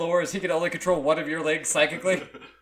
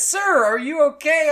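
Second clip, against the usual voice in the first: worried tattoo artist; 10 semitones higher, 8 dB louder, slower than usual. Acted. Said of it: "He says".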